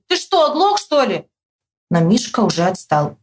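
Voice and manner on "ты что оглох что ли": high, exasperated call; high cry dropping at the tail; shouting. A narrator's reading. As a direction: shout at a friend who won't hear. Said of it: "Я кричу".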